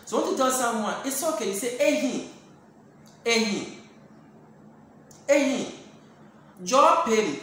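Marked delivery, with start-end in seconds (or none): 1.8-7.4 raised calls of "Ehi" to get attention; firm calls of "Ehi", louder; calling out to stop. Acted. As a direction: call out to make them stop.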